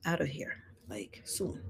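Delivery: hushed, unvoiced aside about leaving soon; whispered aside. Real, not acted. Host confides to viewers she must leave soon, whispered. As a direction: drop to a whisper mid-sentence. Aside while opening the live.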